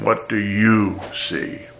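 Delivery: slow "What do you see?" with YOU stressed. Shown as a demonstration. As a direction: land on "you", unhurried. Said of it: "I'm putting the emphasis for us today on".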